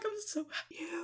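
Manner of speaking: breathless high whisper of joy; a tiny awed whisper. Retold or imitated. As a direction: whisper it, overcome with happiness. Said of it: "and I'm like".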